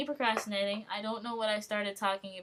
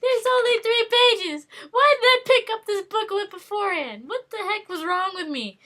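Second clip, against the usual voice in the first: much higher, loud astonished outburst; shocked delight; 11 semitones higher, 11 dB louder than usual. Real, not acted. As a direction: exclaim in amazed disbelief. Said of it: "This is amazing".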